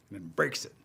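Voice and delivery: usual speaking voice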